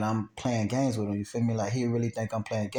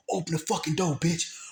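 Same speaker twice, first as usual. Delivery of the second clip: retold door-pounding demand, higher; heated order, pitched up without shouting; angry demand; 6 semitones higher than usual. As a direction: imitate a gunman ordering a door opened. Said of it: "beating on the door".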